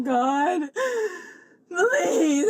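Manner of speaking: usual speaking voice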